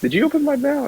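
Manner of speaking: mock disbelief. Retelling to a friend how he asked grandpa, in high mock disbelief. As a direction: act shocked that he opened the mail. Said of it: "I was like".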